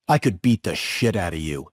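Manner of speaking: low, restrained threat; Charles Durning. Retold or imitated. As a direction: threaten in a dropped, understated voice. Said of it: "And he goes".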